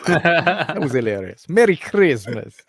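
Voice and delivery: laughing. Real, not acted. Still laughing after the clip.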